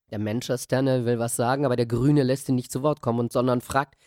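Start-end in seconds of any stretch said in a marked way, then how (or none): none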